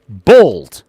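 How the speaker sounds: loud. Pretend. Revealing hairline joke.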